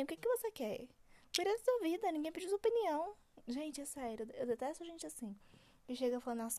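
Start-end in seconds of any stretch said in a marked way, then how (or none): 0.0-3.1 high-pitched indignant retort; exasperated telling-off. Acted. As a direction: tell off a busybody, voice pitched right up. Telling off unsolicited opinion givers.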